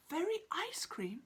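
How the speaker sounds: hopeful. Acted. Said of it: "asked Itchy, hopefully".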